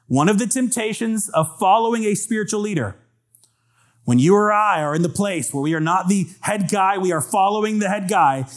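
ordinary talk, nothing marked

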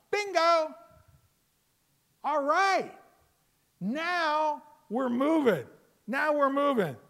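high, loud "Bingo!" like a game-show win; excited shout. Real, not acted. Preacher cheering Peter's confession to the congregation, loud excited shout.

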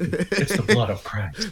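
slow, solemn mock priest line; a hammed-up slow priestly intonation; mock priest intoning. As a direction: intone it like communion.

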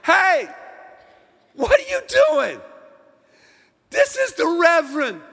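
high, loud scolding shout; loud, high protective friend; retold shout. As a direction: bark it at the swearer like a scandalized friend. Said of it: "my friend goes".

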